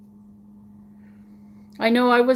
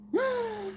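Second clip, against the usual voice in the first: sharp gasp; 12 semitones higher, 8 dB quieter than usual. Real, not acted. Surprise at connection cut.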